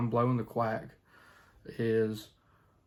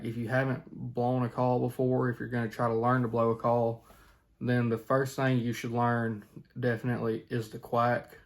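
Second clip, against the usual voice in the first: ordinary delivery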